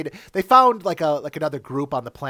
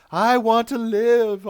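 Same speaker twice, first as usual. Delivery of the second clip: slow, high, loud plea; pleading; 8 semitones higher, 6 dB louder, slower than usual. Retold or imitated. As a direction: beg slowly, voice climbing. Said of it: "Tuvix was begging for his life".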